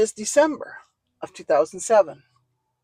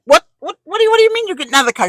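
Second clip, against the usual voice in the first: much higher, fast, rising disbelief; shocked disbelief; 9 semitones higher, 5 dB louder, much faster than usual. Retold or imitated. Asking her fiance why he is getting out, stunned. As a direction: blurt it out in alarm.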